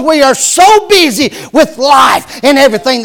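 loud, high, insistent appeal; emphatic Lord. Acted. The Lord calling busy believers, loud and emphatic. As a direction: demand their attention, hammering each line. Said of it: "call our name and say it the second time very emphatically".

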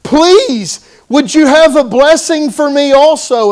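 pleading. Retold or imitated. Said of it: "And he said".

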